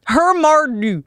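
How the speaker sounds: Hermardu said brightly with joy; joyful Swedish. Shown as a demonstration. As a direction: say Hermardu happily. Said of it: "with joy, right?".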